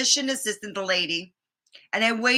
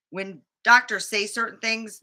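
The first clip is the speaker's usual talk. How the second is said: normal talking voice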